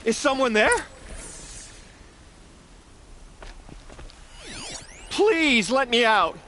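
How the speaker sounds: high, urgent calls to anyone outside; calling out. Acted. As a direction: call out desperately to be freed. Locked in, calling out.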